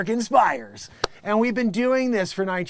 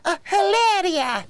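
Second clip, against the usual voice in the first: high, hammed-up fake Spanish accent; 12 semitones higher, 5 dB louder than usual. Comic mocking Hilaria, high fake Spanish accent. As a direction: parody the put-on Spanish lilt. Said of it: "And you come".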